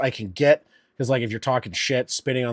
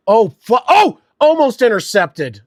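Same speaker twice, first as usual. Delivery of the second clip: higher and louder gasp of surprise; startled near interception; 9 semitones higher, 9 dB louder than usual. Real, not acted. Fan seeing a pass nearly intercepted, startled. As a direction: blurt it out as the ball is almost picked.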